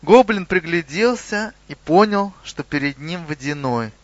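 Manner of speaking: plain reading voice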